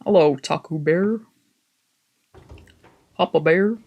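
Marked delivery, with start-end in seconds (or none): none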